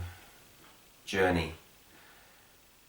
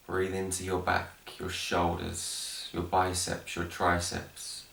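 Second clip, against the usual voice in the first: guided meditation; 3 dB quieter, slower than usual. Acted. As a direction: guide them gently down into the breath, unhurried. Leads guided breathing meditation.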